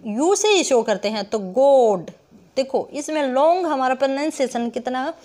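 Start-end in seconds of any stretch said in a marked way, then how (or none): 1.4-2.1 "गोड" drawn out on the vowel; stressed long vowel. Shown as a demonstration. Teacher demonstrates stress to the class, long "गोड". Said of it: "long, इसमें क्या लगा? Stress लगा".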